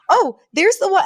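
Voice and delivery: high, delighted mock star-spotting; Fran. Pointing out another paparazzi, imitated high excitement. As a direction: point them out in a high, thrilled voice. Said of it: "over the moon".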